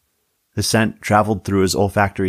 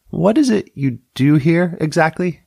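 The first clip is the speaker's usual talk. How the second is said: Tammy voice; 9 semitones higher, 3 dB louder than usual. A narrator's reading. Narrator voices Tammy the deputy.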